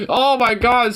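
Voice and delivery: ordinary delivery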